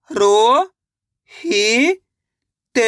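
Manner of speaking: अ, नो, खा drawn out separately, the first two rising; syllable by syllable. Shown as a demonstration. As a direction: sound out अ, नो, खा with long gaps. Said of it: "तो मिलके बन जाएगा". (अ, नो, खा अलग-अलग खींचकर)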